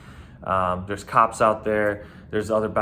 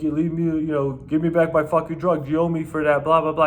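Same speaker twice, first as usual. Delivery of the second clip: higher-pitched mock protest; mocking, pestering demand in a raised pitch; protesting clubgoer; 6 semitones higher, 3 dB louder than usual. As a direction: imitate an indignant man being thrown out. Mimics man being thrown out.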